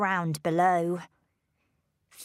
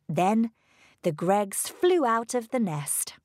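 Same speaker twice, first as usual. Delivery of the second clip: usual speaking voice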